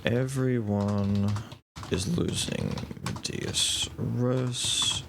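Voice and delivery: slow, drawn-out words while writing; noting it down. Real, not acted. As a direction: talk slowly as you note things down. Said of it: "let me note this down".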